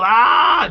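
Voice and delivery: imitated movie yell. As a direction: yell a long "ah" like an action hero. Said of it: "Gun up in the air and yell".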